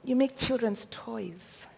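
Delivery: high, patronising sing-song; condescending imitation of a buyer; mocking quote. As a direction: mimic the people who belittle her. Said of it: "Then you say".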